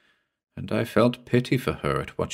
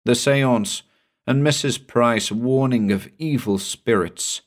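ordinary delivery, nothing marked

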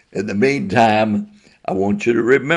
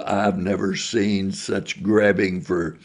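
ordinary delivery

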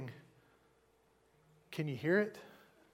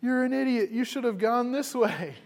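higher, mock-angry yell, half-hearted; mock yelling God; 7 semitones higher than usual. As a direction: play an angry God yelling down, only lightly. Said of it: "yelling at us and saying".

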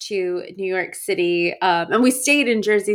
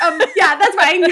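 high, nervous, laughing through it; scared little voice; 9 semitones higher, 9 dB louder than usual. Retold or imitated. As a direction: squeak it out, terrified. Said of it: "frozen in fear".

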